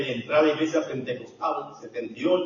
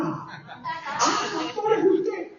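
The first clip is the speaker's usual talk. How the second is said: mock surprise; 13 semitones higher, much slower than usual. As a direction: imitate being startled at seeing the pastor. Acted startled greeting to pastor.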